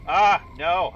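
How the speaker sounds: loud startled cry; alarmed shout. Real, not acted. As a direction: yelp at the bad sound. Alarm at drought sound.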